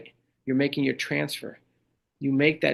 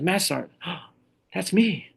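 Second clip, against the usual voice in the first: shocked realization; 5 semitones higher than usual. Retold or imitated. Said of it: "She goes".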